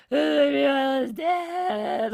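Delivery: slow, loud, high mock wail; imitated blubbering. Retelling crying over a whale on an empty beach, mock blubbering. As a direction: drag the words out in hammed-up sobbing. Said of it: "like, blubbering".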